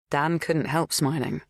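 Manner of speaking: ordinary delivery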